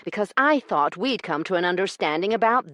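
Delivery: high, loud, furious lady's counterattack. Acted. Io rounding on Corbin for following her, furious. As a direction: turn his words back on him.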